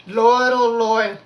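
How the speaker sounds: loud, slow, drawn-out cry to the Lord; prayer invocation. Real, not acted. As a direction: call on the Lord with full voice. Calls out in prayer.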